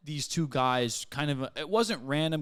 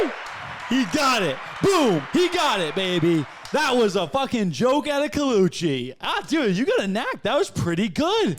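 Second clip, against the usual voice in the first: higher, louder praise shouted out; excited cheering; 8 semitones higher, 6 dB louder than usual. Real, not acted. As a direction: hype him up after the punchline. Cheering friend's joke.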